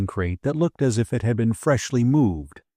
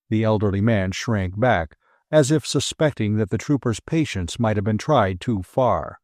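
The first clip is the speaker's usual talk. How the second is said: usual speaking voice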